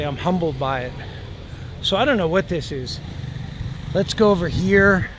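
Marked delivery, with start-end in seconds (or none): none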